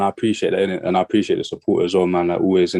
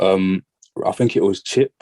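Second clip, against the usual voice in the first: usual speaking voice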